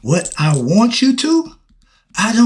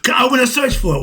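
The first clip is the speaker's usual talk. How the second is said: preaching shout; 8 semitones higher, faster than usual. Real, not acted. Preaching outburst at the crowd.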